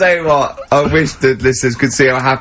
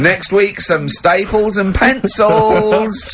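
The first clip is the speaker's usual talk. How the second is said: mock prize announcer; 6 semitones higher, slower than usual.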